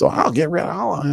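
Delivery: high, hammed-up tyrant dismissing pleas. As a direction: wave them off like a mad king. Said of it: "you'd be a tyrant talking like that".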